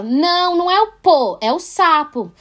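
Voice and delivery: bronca encenada, aguda e mais forte; correção encenada. Acted. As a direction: corrija a criança com um "não" enfático. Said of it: "a criança falou alguma palavra errada". (correção enfática e aguda de adulto)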